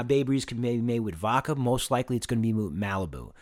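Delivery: normal talking voice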